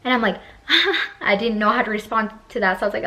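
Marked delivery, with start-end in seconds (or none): 0.4-1.2 high, loud nervous laugh; awkward laugh. Retold or imitated. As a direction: burst of uneasy mock laughter. Retold awkward reaction to advance.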